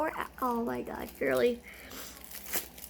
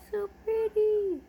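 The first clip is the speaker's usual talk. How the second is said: high, slow coo; 9 semitones higher, 6 dB louder, slower than usual. Real, not acted. Admiring the bracelet from her friend, slow high coo. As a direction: draw it out, adoring.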